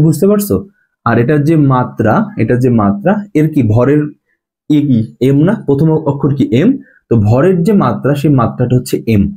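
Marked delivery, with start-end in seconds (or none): none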